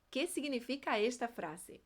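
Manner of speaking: ordinary delivery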